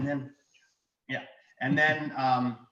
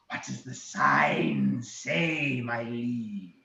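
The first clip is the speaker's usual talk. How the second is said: very slow, drawn-out dragon; 2 semitones lower, much slower than usual. Acted. Dragon asking about the sign, dragon voice. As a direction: stretch each word as the dragon. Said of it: "and he goes".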